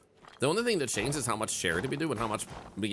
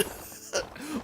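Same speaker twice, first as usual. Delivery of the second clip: breathless high giggle; 4 dB quieter than usual. Real, not acted. Laughs after caveman bit.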